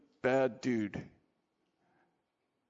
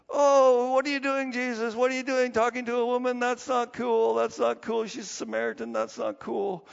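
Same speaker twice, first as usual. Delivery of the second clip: higher and louder, scandalised protest; indignant disciples; 7 semitones higher, 4 dB louder than usual. Acted. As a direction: play the shocked disciples objecting. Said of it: "The disciples are like".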